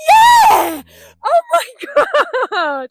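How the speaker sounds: high laughing shout of glee; laughing shriek. Real, not acted. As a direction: squeal with delight at your own joke. Laughing scream at own joke.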